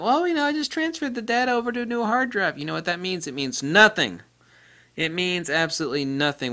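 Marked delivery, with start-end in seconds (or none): 0.0-2.6 much higher, mock naive voice; naive user. Mocking a naive user who copied data once. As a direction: imitate someone sure they're fine.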